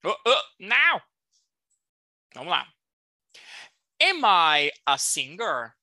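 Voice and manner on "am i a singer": slow, carefully spoken question; slow model question. Shown as a demonstration. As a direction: say it slowly so students can copy. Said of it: "Preste atenção da maneira como eu falo".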